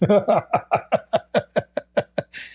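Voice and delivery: high breathy chuckle; short mocking laugh; amused laugh. Real, not acted. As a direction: laugh at your own joke. Laughing at his joke.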